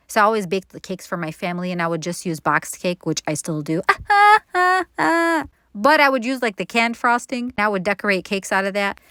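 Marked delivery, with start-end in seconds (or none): 3.8-5.5 a bright, high burst of real laughter. Real, not acted. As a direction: laugh at yourself, caught out. Laughs after admitting boxed cake.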